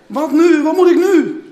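high, loud desperate outcry; uitroep. A narrator's reading. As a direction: cry out loudly, at a loss. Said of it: "riep hij". (luid en hoog uitgeroepen)

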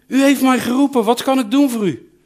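put-on an eager young boy just woken; lightly voiced eager little boy. Boy Samuel runs to Eli again.

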